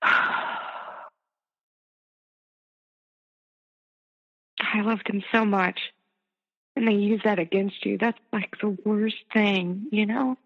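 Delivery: upset, barely holding it together; choked up. Real, not acted. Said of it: "You okay?".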